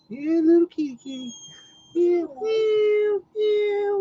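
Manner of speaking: very high, cooing meow; mock the dog as a mewing little kitty; kitty cat voice. Teasing the beagle that he sounds like a cat: very high cooing meow. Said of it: "That's what you sound like".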